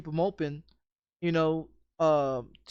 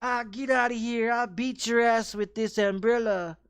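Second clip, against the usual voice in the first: imitating the stranger; 5 semitones higher than usual. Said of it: "He's like".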